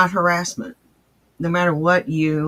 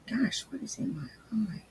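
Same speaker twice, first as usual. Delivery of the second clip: real whisper to himself; much softer whisper about his eye; whispered aside; 3 semitones higher, 13 dB quieter than usual. Real, not acted. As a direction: breathe it out under his breath. Muttering aside, something in eye.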